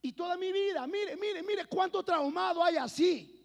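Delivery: high, loud pulpit cry; preacher at full force, slowing on the point; impassioned preaching. Acted.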